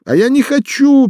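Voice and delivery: high, loud cry cutting in; outburst. Acted. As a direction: shout to interrupt. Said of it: "перебила его мать".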